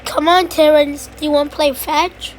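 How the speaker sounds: ordinary talk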